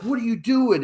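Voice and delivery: mock outburst, pitched high; retold angry challenge, high but not shouted; exploding in outrage. Exploding girl at the bar confronts lemon thrower, mock outrage. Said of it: "now she explodes".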